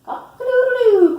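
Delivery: high, loud, long crow; rooster crow. A narrator's reading. Reader voices the rooster in a picture book, crowing. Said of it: "crowed the rooster".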